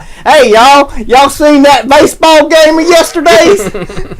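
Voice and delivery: high, loud, slow hammed-up Southern drawl; fake Southern accent. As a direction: parody the movie Southern accent, broad and slow. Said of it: "do a Southern accent in a movie".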